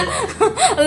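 high loud laugh; burst of laughter. Real, not acted. Laughing at friend's fading voice. (tertawa lepas)

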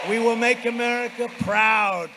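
loud, high rally shout; roaring rallying speech. Real, not acted. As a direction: call it out to the crowd. Rally finale.

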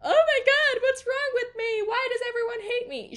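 put-on high, tearful ten-year-old self; hammed-up wail of a hurt child; mock crying. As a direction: imitate a kid crying that everyone hates them. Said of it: "made my ten-year-old heart cry".

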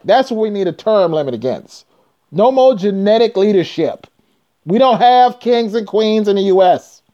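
normal talking voice

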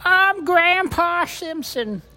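put-on high, loud, slow old-man creak; Grandpa Simpson voice. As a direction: shout it slowly like a cranky old cartoon grandpa. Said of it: "I can do".

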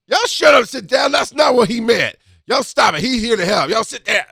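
loud, high shout telling them to sit; scolding shout. Retold or imitated. Mother shouting at her grown kids to sit down, loud scolding.

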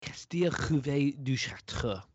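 slow, showy French accent; hammed-up French accent. Shown as a demonstration. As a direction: draw it out in your finest French. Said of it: "In my finest French accent?".

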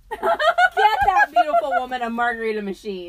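laughing so hard it turns into a cheer; loud laughing demand. Real, not acted. Cheering on the grandma to her co-host, loud laughter.

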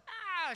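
very high, loud imitated toddler shriek; mock child's scream. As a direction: shriek like a child having a meltdown. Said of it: "she just starts screaming".

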